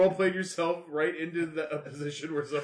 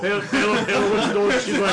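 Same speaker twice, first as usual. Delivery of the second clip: excited laughing; 4 semitones higher, 10 dB louder than usual. Acted. Excited, laughing in-character reaction.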